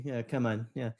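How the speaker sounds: usual speaking voice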